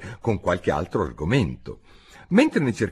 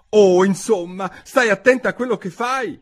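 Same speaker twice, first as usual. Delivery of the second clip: higher, louder, scolding in alarm; alarmed cry; 12 semitones higher, 6 dB louder than usual. Acted. Alice shouting at the cook throwing pans, alarmed cry. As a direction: yell a warning at the cook.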